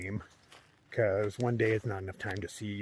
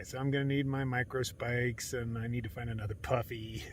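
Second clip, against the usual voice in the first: usual speaking voice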